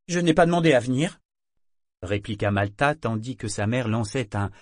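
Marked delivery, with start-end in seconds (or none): 0.1-1.2 put-on higher, louder, fast teen retort; sulky retort. A narrator's reading. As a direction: snap back like a sulky teenager. Girl retorts to grandmother.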